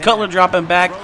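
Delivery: normal talking voice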